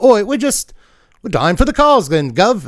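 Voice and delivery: high-pitched hammed-up British underling; mock-British, Monty Python style protest; British accent. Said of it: "doing the British thing".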